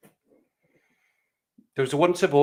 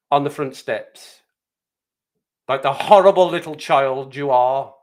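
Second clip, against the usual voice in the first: loud, hammed-up head teacher fury; outraged expulsion; 6 dB louder than usual. Head teacher expels the boy.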